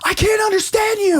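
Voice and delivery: very high-pitched, frantic imitation; mock-panicked high squeal; panicked cry. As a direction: act out someone crying out in alarm. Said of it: "like, concerned or fearful".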